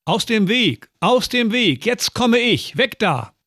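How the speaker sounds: high, excited yell to clear the way; calling out. Acted. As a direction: shout ahead from the sled, a child racing downhill. Sledding child yells to clear way.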